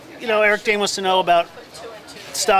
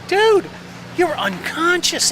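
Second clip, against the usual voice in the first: high, slowed, amazed praise; excited praise; 10 semitones higher, slower than usual. Retold or imitated. Co-star praising him after the scene. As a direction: gush about the take. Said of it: "Eric's going".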